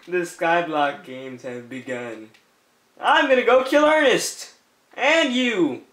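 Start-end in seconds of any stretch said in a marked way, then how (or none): 0.1-2.3 dramatic announcer. Pretend. Re-announcing the game start.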